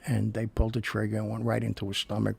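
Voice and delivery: ordinary delivery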